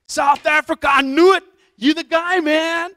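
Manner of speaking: loud, high-pitched fanboy outburst; wildly excited teller. Retold or imitated. Teller recognizing the man from South Africa, wildly excited. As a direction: imitate a star-struck American dude yelling. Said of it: "And he's so excited".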